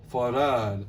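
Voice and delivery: rain said with open "ah", rahn. Shown as a demonstration. Director shows rain with open ah vowel. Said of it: "mispronounce the word rain".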